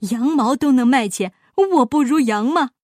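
loud, indignant retort; outraged miser voice; furious. A narrator's reading. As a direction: protest as if deeply insulted. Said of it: "巴依老爷怒气冲天".

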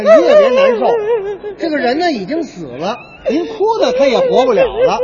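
hammed-up condolence speech; the mourner now loudly comforts the consoler; loud mock consoling. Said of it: "你哭，我劝你。".

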